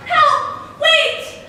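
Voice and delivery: high, loud panicked cries for help; loud, high terrified calls; frightened cry. A narrator's reading. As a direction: shriek it like waking from a nightmare. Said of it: "in her sleep cried out".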